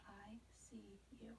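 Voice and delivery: soft whisper for a hearing test. Shown as a demonstration. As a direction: breathe the words out unvoiced. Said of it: "I'm gonna whisper to you".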